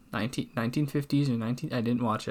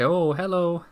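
raised, louder "oh, hello"; echoed high greeting; 7 semitones higher, 5 dB louder than usual. Acted. Cowboy returns the barkeep's greeting, high and loud. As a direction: answer in a high, sing-out greeting.

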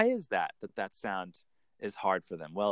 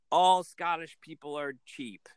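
slow, lightly put-on Scottish accent; mock a Scottish cliché, slowly; 4 semitones higher than usual.

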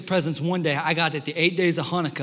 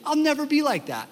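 put-on awestruck kid; 9 semitones higher than usual. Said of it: "And I was like".

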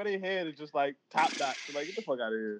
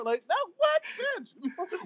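much higher mock girlfriend voice, outraged; jealous girlfriend; 12 semitones higher, 4 dB quieter than usual.

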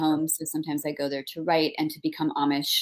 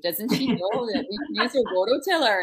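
higher-pitched Minnesota neighbor voice; Minnesota accent; 5 semitones higher, 3 dB louder than usual. Retold or imitated. Neighbors puzzled by writer digging with a spade, Minnesota accent. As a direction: gossip in a put-on Minnesota accent. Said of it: "my neighbors in rural Minnesota are like".

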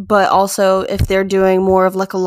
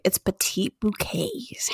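hushed, slow whisper; 12 dB quieter, slower than usual. Real, not acted. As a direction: breathe it like a secret. Excited whisper about favorite.

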